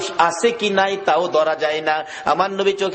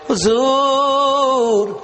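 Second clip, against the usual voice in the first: high, long-drawn cry; retold plaintive call; 7 semitones higher, 3 dB louder, much slower than usual. Children answer the Prophet about their father, plaintive drawn-out call. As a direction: stretch the word out as an imitated pleading call.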